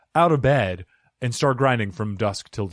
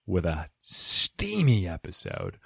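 sultry; 6 semitones lower, 6 dB quieter, slower than usual. Pretend. Sultry tease of steamy episode.

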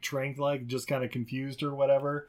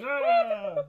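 very high fake scream; mock shriek. Podcaster ending the joke with a high comic shriek. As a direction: let out a hammed-up squeal.

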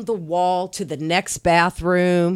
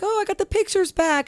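high, put-on voice, delighted; mock excited girl with her photos; giddy younger self. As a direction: squeal it out happily. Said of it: "I was like".

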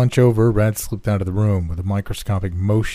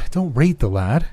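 lightly voiced higher woman; raised-pitch gentle reproach; industrialist's wife; 6 semitones higher than usual. A narrator's reading. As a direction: intervene for the boy in a lifted voice. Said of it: "said the industrial's wife".

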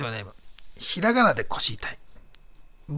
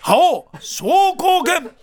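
high, slow shouted attack name; special move shout; 12 semitones higher, 5 dB louder, much slower than usual. Shown as a demonstration. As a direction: bellow the super move like a game fighter. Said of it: "これですね。".

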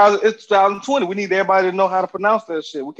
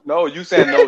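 ordinary delivery

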